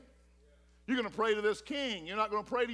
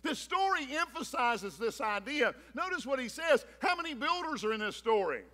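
ordinary talk, nothing marked

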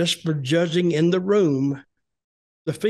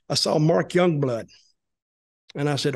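ordinary delivery

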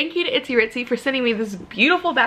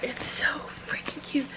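soft, breathless whisper; hushed, adoring whisper; 2 semitones higher, 11 dB quieter than usual. Real, not acted. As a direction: whisper it like a secret delight. Whispering over cute bag.

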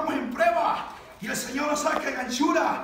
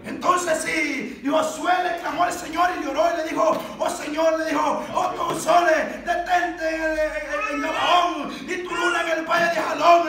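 raised, fervent preaching voice; full-force preaching; 4 semitones higher, 5 dB louder than usual. Real, not acted. Preacher retells Joshua commanding the sun, full-force preaching. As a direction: call it out as a sermon climax.

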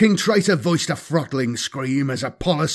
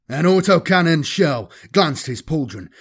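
plain reading voice

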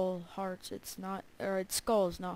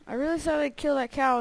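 louder and higher in frustration; exasperated outburst; 5 semitones higher, 12 dB louder than usual. Real, not acted. Gamer venting to viewers about the lost cow. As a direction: blurt it out, annoyed.

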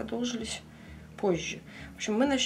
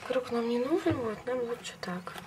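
ordinary talk

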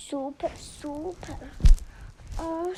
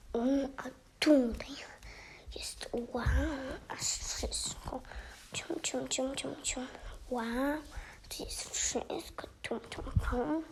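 whispering; 6 dB quieter than usual. Real, not acted. Exploring toy house quietly.

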